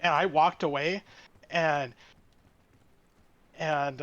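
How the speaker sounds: ordinary delivery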